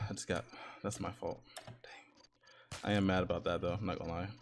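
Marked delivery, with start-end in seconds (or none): 0.1-2.8 much softer, let down; muttered disappointment. Real, not acted. Gamer mumbling to himself after losing, deflated. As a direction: breathe it out, deflated.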